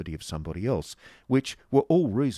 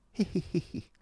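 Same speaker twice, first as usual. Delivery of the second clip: high, thin "he-he" giggle; forced little high-pitched titter; affected titter; 11 semitones higher than usual. Acted. Miss Squeers giggles to the Yorkshireman, affected titter. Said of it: "tittered Miss Squeers".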